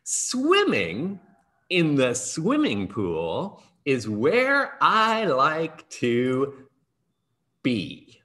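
slow recited poem, each line set apart; poem recital. Acted. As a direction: read your poem to the kids with a pause between lines. Reads his poem aloud.

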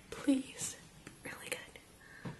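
hushed hopeful whisper. Real, not acted. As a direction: breathe it as a hopeful wish. Hopeful whisper over a swatch.

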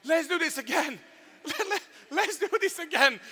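high, laughing call to go again; excited shout. Retold or imitated. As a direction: cheer like thrilled teens begging for another ride. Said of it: "they start shouting".

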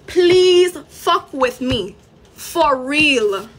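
loud, drawn-out "please", then slow threat; angry dare. Real, not acted. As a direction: dare them slowly, leaning hard on the first word.

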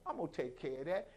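softened, comforting parental tone; quietly soothe, as to a crying child; God reassuring. Acted. God telling the congregation not to cry, soft reassurance. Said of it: "God is saying the same thing".

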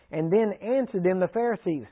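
ordinary delivery

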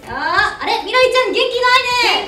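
high, loud mock-teacher prodding; single out a pupil with hammed-up concern; pretend teacher. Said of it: "そんな先生は嫌だをやりました".

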